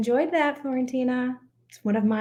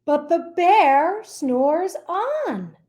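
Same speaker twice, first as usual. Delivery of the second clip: slow refrain; 5 semitones higher, 5 dB louder, much slower than usual. Acted.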